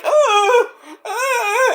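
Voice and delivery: put-on high wailing child sob; fake-bawl like a toddler; mock crying. Scared kids bawling at ringside, mock crying. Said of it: "crying like little bitches".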